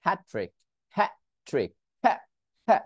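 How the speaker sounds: "hat" cut off with the T held back; stopped T. Shown as a demonstration. Teacher showing students where the sound gets stuck, stopped T. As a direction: stop dead on the T of "hat". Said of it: "উচ্চারণে আটকে গেছি".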